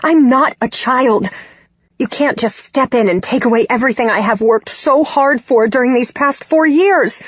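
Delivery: high-pitched, angry tirade; yelling. A narrator's reading. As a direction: keep hurling accusations at your mother. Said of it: "I continue to yell as I say".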